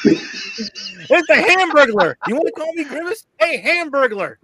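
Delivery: high, loud, laughing teasing call; laughing taunt. Pretend. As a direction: crow it at him through laughter. Teasing Gary as Hamburglar.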